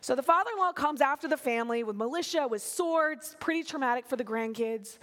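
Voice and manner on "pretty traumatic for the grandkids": usual speaking voice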